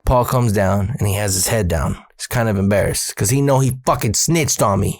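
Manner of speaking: usual speaking voice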